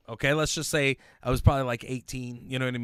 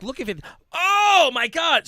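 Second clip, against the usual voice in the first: shocked exclamation; 11 semitones higher, 8 dB louder than usual. Retold or imitated. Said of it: "because I was like".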